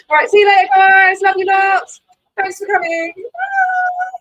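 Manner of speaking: shouted high cheery goodbye to the viewers; signing off the stream with a loud, high goodbye; loud farewell. Real, not acted. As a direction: call it out big and high like waving off friends.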